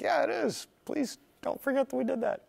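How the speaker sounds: high, needy plea for credit; pleading. Retold or imitated. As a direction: beg them not to forget. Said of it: "And you're like".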